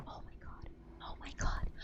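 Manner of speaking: barely audible panicked whisper; hushed, repeated whisper of dread; frightened whisper. Real, not acted. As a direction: whisper it under your breath, scared. Frightened by monster in game.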